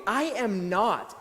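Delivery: slow, firm "I am not" with each word pressed; emphatic denial. Retold or imitated.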